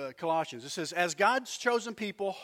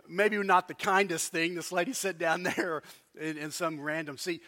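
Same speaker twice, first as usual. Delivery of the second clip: ordinary delivery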